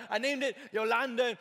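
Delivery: Yolanda with a Spanish accent, rolled and open; a light Spanish accent on Yolanda. Shown as a demonstration. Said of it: "You gotta say it with an accent".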